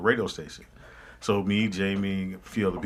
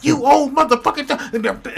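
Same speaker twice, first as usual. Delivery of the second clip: high, slow, loud insult; hammed-up furious star; mock angry cussing; 7 dB louder, slower than usual. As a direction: hurl it at the old man. Retold Foxx cussing.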